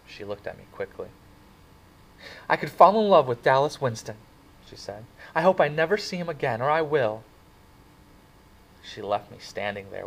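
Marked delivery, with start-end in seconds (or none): 2.5-7.2 breathless confession. Acted. Cherry confessing about Dallas.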